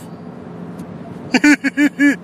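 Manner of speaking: high cracking laugh; burst of laughter. Real, not acted. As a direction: laugh at your own joke. Laughs at own skit.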